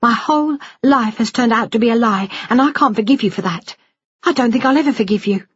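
high-pitched unforgiving outburst; wounded daughter's bitter tirade; angry, hurt daughter. Acted.

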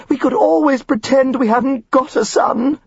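comic wailing Mrs Elliot; wailing mother. A narrator's reading. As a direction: moan it like a melodramatic wife. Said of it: "Missus Elliot wailed".

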